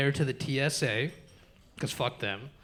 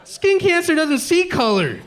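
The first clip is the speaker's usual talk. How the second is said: mock outraged yell; 12 semitones higher, 3 dB louder, much slower than usual. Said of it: "And I go".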